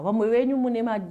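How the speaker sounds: ordinary talk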